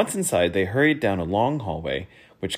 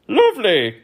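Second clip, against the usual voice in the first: high, loud courtly voice; prim courtier; affected minister; 13 semitones higher, 9 dB louder than usual. Acted. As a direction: offer the word sweetly. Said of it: "counseled the minister".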